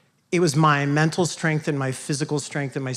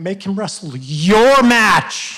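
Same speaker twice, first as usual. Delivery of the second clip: dad's shout from the side; 5 semitones higher, 9 dB louder, much slower than usual. Retold or imitated. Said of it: "I remember my dad saying".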